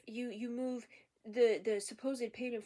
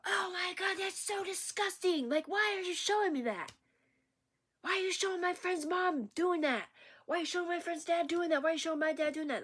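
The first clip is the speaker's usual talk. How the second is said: much higher, louder, whiny disgust; high mock outrage from scandalized onlookers; mocking disgusted bystanders; 8 semitones higher, 4 dB louder than usual. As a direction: mimic a shrill crowd shocked and grossed out. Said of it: "You hear these people like".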